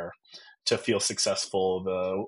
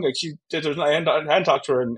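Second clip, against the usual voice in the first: normal talking voice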